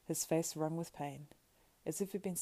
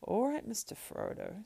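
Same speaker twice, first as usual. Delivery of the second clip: higher-pitched, taken-aback assent; rather startled; 7 semitones higher than usual. Acted. Sam answers Frodo's sudden demand, rather startled. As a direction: placate him while caught off guard. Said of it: "rather startled".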